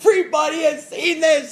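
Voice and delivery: excited. Retold or imitated. Laughing, calling out to everyone.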